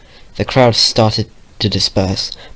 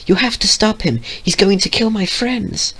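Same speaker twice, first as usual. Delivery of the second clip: timid pony voice; 12 semitones higher than usual. Acted. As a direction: beg him in a small high voice. Fluttershy begging Vio.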